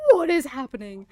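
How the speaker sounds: loud re-enacted bewilderment; retold tweaking out. Streamer reliving the chat's weird turn, tweaking out. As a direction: blurt it out, re-enacting the freak-out. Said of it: "genuinely tweaking out".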